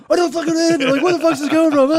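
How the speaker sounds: high, loud, exasperated yelling; mock frantic outburst. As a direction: imitate someone snapping in a quarrel. Acts out trapped partner blowing up.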